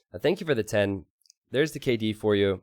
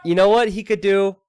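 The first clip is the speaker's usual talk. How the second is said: excited; 9 semitones higher, 6 dB louder than usual. Real, not acted. Caster spots an excited play.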